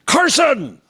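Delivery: shouted name. Acted. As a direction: shout across the newsroom for Carson.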